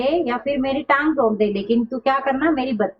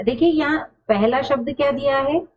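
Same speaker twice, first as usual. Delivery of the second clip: normal talking voice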